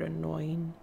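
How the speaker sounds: ordinary delivery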